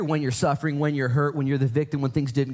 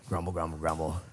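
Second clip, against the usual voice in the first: mock grumbling; 8 semitones lower, 8 dB quieter than usual. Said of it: "imitating grumbling".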